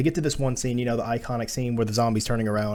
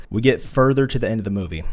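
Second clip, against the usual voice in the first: usual speaking voice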